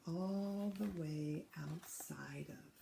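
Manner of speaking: slow, soft unvoiced whisper. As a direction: let it drop to a hushed whisper. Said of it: "So I'll hold it back a little".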